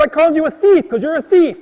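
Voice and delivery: high, loud retold accusation; angry accusation. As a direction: insist on it hotly, mid-quarrel.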